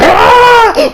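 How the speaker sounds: high yell. Retold or imitated. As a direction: scream like a fighter pushing past his limit. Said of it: "screaming at the top of his lungs".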